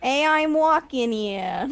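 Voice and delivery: slow, high New York cabbie voice; hammy New York accent. Shown as a demonstration. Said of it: "someone to do a bad New York accent".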